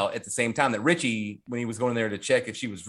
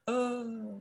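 high, soft, drawn-out "oh"; dramatic slow-motion oh; 10 semitones higher, 8 dB quieter than usual. Retold or imitated. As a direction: mimic slowed-down dramatic shock, softly. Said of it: "like, all dramatically".

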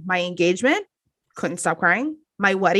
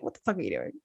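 mock quiet; 12 semitones lower, 12 dB quieter, much faster than usual. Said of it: "it's like".

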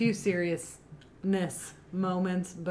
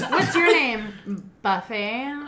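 mock movie line through laughter; laughing quote; 3 semitones higher, 5 dB louder, slower than usual. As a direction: laugh while quoting the line.

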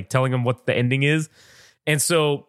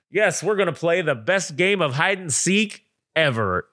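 slow, higher mock megaphone announcement; 4 semitones higher, slower than usual. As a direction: imitate a kid announcing through a megaphone. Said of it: "has this fucking megaphone thing".

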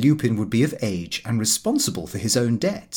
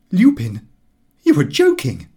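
louder incredulous question; astonished father; 5 semitones higher, 7 dB louder than usual. Acted. Father doubting his son's windfall, loud and astonished. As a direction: press him in disbelief.

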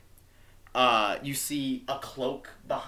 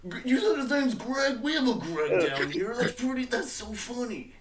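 high, surprised servant voice; a much higher, amused orc servant; Tin Can; 11 semitones higher than usual. Acted. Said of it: "Tin Can's like".

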